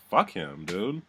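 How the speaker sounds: ordinary talk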